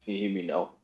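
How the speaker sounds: faint and distant on the line; barely audible. Real, not acted. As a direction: still very faint, hard to hear. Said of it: "I hear you, but it's very low".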